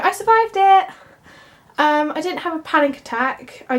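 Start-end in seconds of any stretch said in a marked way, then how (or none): 0.0-0.9 high, loud proud announcement; triumphant. Real, not acted. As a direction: declare it like a small victory. Triumph after anxious outing.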